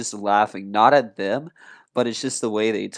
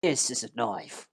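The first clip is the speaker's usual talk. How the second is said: softer mock Australian accent; imitation Aussie accent; 2 semitones higher, 6 dB quieter than usual. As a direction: show it off in put-on Australian. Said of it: "do that Australian accent".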